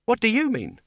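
high-pitched baffled question; baffled retort. A narrator's reading. Two speakers in verbal deadlock.

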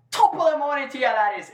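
put-on high, loud stage-Irish accent. Host showing how to go, hammed-up Irish accent. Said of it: "You can't just go".

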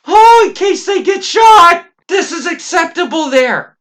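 high, loud mock outrage; sarcastic shout. Ranting to podcast co-host about bulletproof backpacks, sarcastic shout. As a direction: yell it like the idea is insane. Mocking absurd Kevlar backpack logic.

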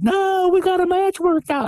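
mock panicked objection, high pitched; flipping out. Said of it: "he started flipping out".

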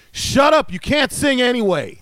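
put-on sudden high, loud snap at a child; frustrated mom shouting at her singing daughter; mother's angry yell. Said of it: "she yells out".

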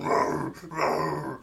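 rolling growl imitating a creature; monster growl. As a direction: growl like a beast behind a door. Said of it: "there's a thumper behind it going".

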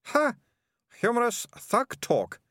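put-on very high triumphant girl's voice. A narrator's reading. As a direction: crow it like a point scored. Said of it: "said Melicia triumphantly".

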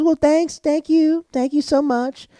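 high, sweet imitation of her thanks; light high-pitched female thanks. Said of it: "She goes".

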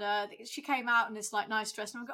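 usual speaking voice

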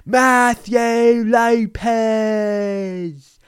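high, booming, very drawn-out name call; long sustained arena introduction; ring announcer shout. Acted. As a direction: bellow the name like a wrestling announcer. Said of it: "British accent for that, but".

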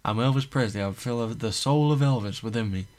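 over-the-top [name] impression; [name] accent. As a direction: play a man who can't stop being [name]. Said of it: "he's still got the [name] accent".

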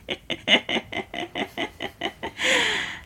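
high, airy burst of laughter; high breathy laugh. Real, not acted. Laughs at joke.

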